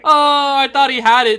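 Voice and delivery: loud, high disbelief; excited. Real, not acted. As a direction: react to the near miss. Close play just fails.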